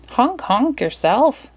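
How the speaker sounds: high, slow goose voice; cheeky geese. Acted. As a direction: honk back at the horn, playfully. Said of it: "answered a gaggle of geese".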